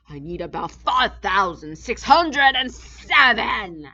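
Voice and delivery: loud, pompous royal bluster; a greedy king shouting orders; boastful turtle king. A narrator's reading. Yertle the king voice.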